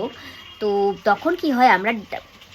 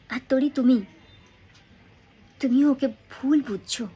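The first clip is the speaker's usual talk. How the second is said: pause, then lean gently on ভুল, drawing it out; stress on ভুল; 2 semitones higher than usual. Shown as a demonstration. Actress demonstrates her serial line, stressing ভুল with feeling. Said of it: "কোন ওয়ার্ডের প্রতি আমি জোর দিচ্ছি".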